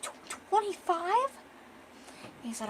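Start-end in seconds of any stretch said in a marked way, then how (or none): 0.0-1.3 high, drawn-out disbelieving question; slow, shocked echo; incredulous. Retold or imitated. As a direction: stammer it in disbelief, pitched way up. Man shocked at drink count.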